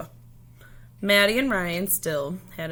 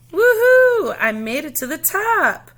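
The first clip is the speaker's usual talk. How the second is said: very high, loud cheer of triumph; a kid shouting with joy at reaching the top; excited shout; 11 semitones higher, 10 dB louder than usual. A narrator's reading. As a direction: whoop it out from the top of the wall. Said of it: "Sophia shouted".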